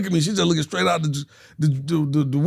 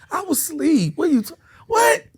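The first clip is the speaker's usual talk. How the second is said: very high, louder protest; high hammed-up sputtering; retold indignation; 3 dB louder than usual. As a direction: squeal it in mock disbelief.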